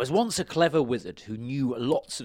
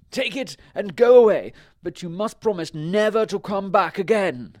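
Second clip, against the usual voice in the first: high, very loud command; story wizard; 9 semitones higher, 12 dB louder than usual. A narrator's reading. Wizard sends the baron away, high loud command. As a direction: lay down the terms loudly. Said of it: "he said".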